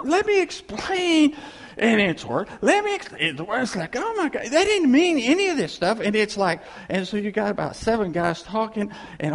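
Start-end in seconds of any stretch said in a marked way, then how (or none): 0.0-1.3 high, loud, stretched-out plea; slow, high-pitched acted desperation; mock desperate pleading. Acts out his own frantic pleading. 2.6-3.1 high, loud, stretched-out plea; mock desperate pleading. Repeats the frantic plea, cut off. 4.0-6.0 high, loud dismayed protest; hammed-up high-pitched exasperation; mock exasperation. Acts out dismay at worsening misunderstanding.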